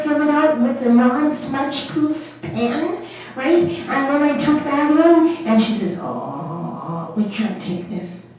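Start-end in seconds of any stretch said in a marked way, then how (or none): 6.0-8.3 lower, softer, slow mock official refusal; a hint of a stern passport clerk; prim clerk. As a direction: play the fussy clerk denying it slowly.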